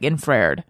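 "infrared" said to rhyme with paired; "infrared" run into two syllables, like paired; mispronounced infrared. Shown as a demonstration. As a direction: say "infrared" as in-FRAIRD. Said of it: "infrared does not rhyme with paired".

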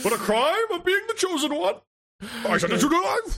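high, hammed-up royal decree; king voice. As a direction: pronounce the verdict with mock gravity. Mock king continues sentencing.

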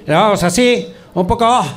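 a lightly hammed Basque accent demo; exaggerated Basque accent. Shown as a demonstration. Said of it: "acento tan típico que hacíamos".

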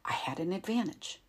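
ordinary delivery, nothing marked

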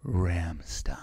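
very low, drawn-out ASMR voice; hushed, playfully sensual murmur; mock ASMR. Shown as a demonstration. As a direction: breathe it slowly, close to the mic. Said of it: "let me try doing A S M R".

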